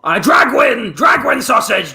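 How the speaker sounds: loud, insistent shouting; shouting demand. Acted. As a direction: bellow the order at him. Customer demands sausage.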